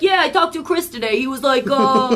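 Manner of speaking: a hint of the same cocky charmer; that guy voice. Showing how she imitates anyone, mild slick guy voice. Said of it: "I do that voice all the time".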